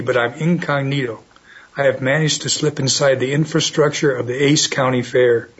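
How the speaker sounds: ordinary delivery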